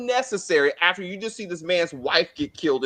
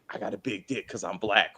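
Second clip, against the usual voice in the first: mock deep boast; 10 semitones lower, 6 dB quieter than usual. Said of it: "And then he's like".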